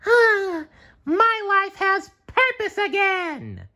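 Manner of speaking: very high, slow, blissful sponge voice; contented SpongeBob sigh. A narrator's reading. SpongeBob back at the Krusty Krab, blissful sigh. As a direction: sigh it out happily in the high cartoon voice. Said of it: "he said with a sigh".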